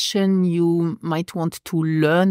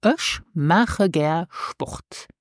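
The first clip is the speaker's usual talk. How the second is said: slow model phrase; much slower than usual. Shown as a demonstration. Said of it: "This is".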